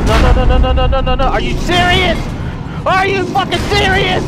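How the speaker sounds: loud stream of protest climbing high; outraged panicked shouting. Real, not acted. Caught by boss, protesting.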